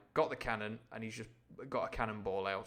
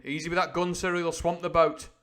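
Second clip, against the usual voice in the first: louder, higher commanding call; crying out; 7 semitones higher, 9 dB louder than usual. A narrator's reading. Captain shouting orders at the rowers, raised voice. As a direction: bark orders across the boat. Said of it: "cried the captain".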